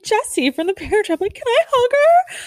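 rising into a starstruck squeal; giddy disbelief. Real, not acted. Fan tells listeners about hugging her childhood star, squeaky and giddy.

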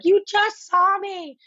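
high, drawn-out mock wail; exasperated squeal, stretching each word out; indignant retold protest. Guest protesting to hotel security who won't let her back in, high indignant whine.

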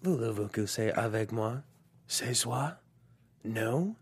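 slow, soft seductive French; suave villain. Acted. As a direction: purr it like a movie villain. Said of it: "our action movie tagline".

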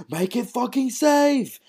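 high, slow, half-yelled imitation of shouting in the tunnel; imitating a yell. Fan imitating the tunnel scene, high and slow mock yell. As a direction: cry it out high and drawn out, like a panicked order underground. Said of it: "When he's like".

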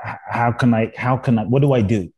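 usual speaking voice

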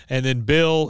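plain reading voice

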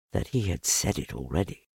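ordinary delivery, nothing marked

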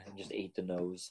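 normal talking voice

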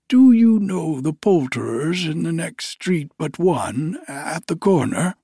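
put-on high, loud, elated old miser; giddy old Scrooge. Scrooge overjoyed on Christmas morning, high giddy voice. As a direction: bubble over with joy as old Scrooge.

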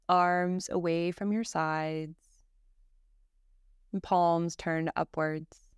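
calm, even yoga nidra guidance with long pauses; guided meditation. Acted. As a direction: guide a listener gently into rest, phrase by phrase.

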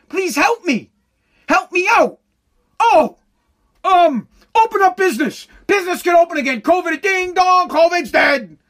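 high-pitched exasperated cries for help; mock frantic announcement; mock desperate plea. Acts mock panic, cries for help.

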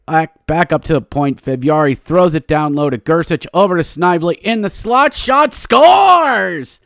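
goal call building to a high loud shout; hockey play-by-play. Real, not acted. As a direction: build to a big scoring call.

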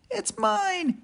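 high squeaky squirrel cry; Cyril shouting. Acted. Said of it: "shouted Cyril".

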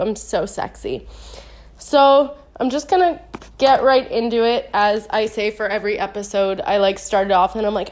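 ordinary talk

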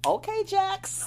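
high, slow, drawn-out reply; mock impressed reaction. Host reacts to Jax's firing of Curtis, high mock amazement. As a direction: stretch it out high, playfully taken aback.

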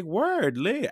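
high, loud hype reply; excited. Retold or imitated. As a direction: hype it up like a done deal.

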